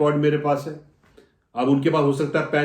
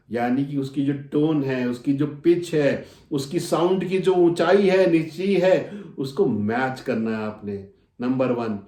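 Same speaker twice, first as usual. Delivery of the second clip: ordinary delivery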